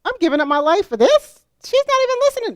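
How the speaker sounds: exasperated thought. Retold or imitated. Mother recalling her frustration with teen daughter, exasperated whine. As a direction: replay your fed-up inner whine. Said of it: "I just thought".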